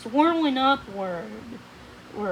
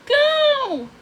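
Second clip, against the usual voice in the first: urgent shout; 14 semitones higher, 7 dB louder than usual. A narrator's reading. Odette urging him away.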